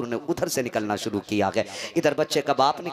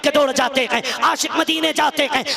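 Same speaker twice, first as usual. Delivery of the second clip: louder, higher preaching peak; impassioned preaching; 12 semitones higher, 8 dB louder than usual. Acted. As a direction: call out to the congregation with fervour. Sermon climax over music. (ऊँची आवाज़ में जज़्बाती ख़िताब)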